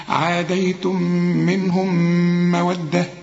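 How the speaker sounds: "عاديتم منهم مودة" with long hummed m at both joins; idgham met ghunna. Shown as a demonstration. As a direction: merge each final m into the next, held nasally. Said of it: "وبرضو منهم بتنتهي بميم".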